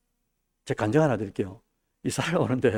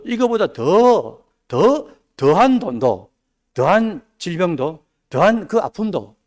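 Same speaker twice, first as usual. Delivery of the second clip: louder, slow and drawn out, each phrase stretched; slow rising preaching shout; 3 semitones higher, 5 dB louder, much slower than usual. Acted. Pastor drives home suffering to congregation, slow loud build. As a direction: build it up slowly and loudly like a preacher.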